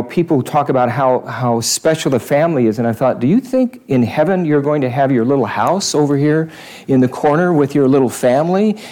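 usual speaking voice

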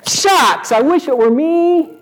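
high-pitched, hammed-up wishful onlooker; mock envious crowd. Preacher playing the crowd talking to Zacchaeus, mock envy. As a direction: draw it out in playful, wistful envy. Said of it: "The crowd said".

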